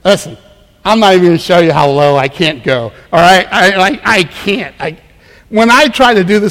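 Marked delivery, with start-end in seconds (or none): none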